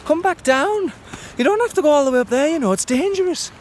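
very high, loud fretting mother; worried mum. Retold or imitated. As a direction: squeal it like a panicky mum. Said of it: "Should be saying".

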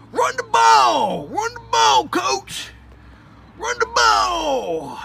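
high, loud, slow fan yell; yelling at coach. Acted. Sports fan yelling at the coach to run the ball, high loud shout. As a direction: bellow it at the coach from the stands.

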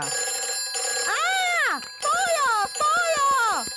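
high, urgent alarm cries; excited shouting. Acted. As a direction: call out as if fire broke out. Firefighters rush to an alarm.

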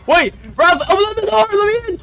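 panicked yelling. Acted. Locked out, begging Raph to open the door, high panicked yell. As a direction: scream at the door in terror.

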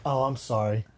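soft fake-innocent sorry; fake apology. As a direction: pretend you bumped him by accident. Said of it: "I'll act like I hit him on accident".

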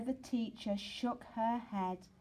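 plain reading voice

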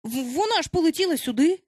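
high, stammering worried protest; alarmed. A narrator's reading. Peter insists Wendy flew here, alarmed. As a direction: stumble in, then raise the pitch anxiously. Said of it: "уже трохи стривожено".

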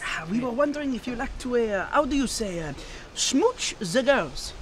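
put-on lightly held French accent, slowing on "smooch"; a hint of a suave Frenchman. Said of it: "[name], what was that accent?".

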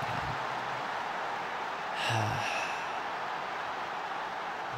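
long, breathy sigh of frustration; sighing at the game going wrong; exasperated sigh. Real, not acted. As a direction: let out a fed-up sigh.

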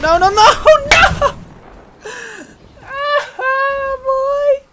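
high, stretched-out anguished wail; mini breakdown. Real, not acted. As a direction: wail as if everything is lost. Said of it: "I'm having a mini breakdown".

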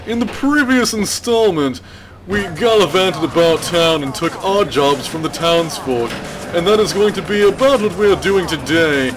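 hammy British narrator opening the episode; bad English accent. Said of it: "I actually have no idea what spurred my interest in talking like that".

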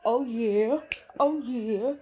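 high, loud gleeful "oh yeah"; delighted mock cheer at sister's loss. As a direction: cheer like you just won the prize. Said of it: "I'm like".

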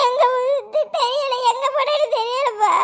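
high, tearful wail; crying. Acted. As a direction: answer through sobs like an upset child. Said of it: "அழாத, அழாத".

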